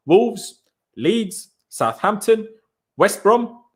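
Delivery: ordinary talk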